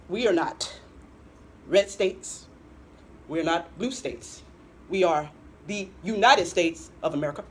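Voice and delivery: a light take on Obama's measured oratory; Barack Obama. Retold or imitated. Host showing off her Obama to her co-host, in a lightly voiced oratorical cadence. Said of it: "This is Barack Obama".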